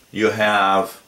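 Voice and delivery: ordinary delivery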